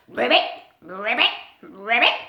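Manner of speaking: loud puffed-up croak; frog croak. Acted. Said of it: "puffed himself up and croaked".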